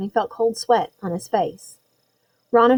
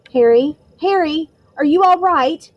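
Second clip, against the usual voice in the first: louder, higher urgent call; worried call; 6 semitones higher, 6 dB louder than usual. A narrator's reading. Friend calling to fainted Harry, worried and urgent. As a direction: shake someone awake with worry.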